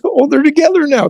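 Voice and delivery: the niece's bright, high relief. Retold or imitated.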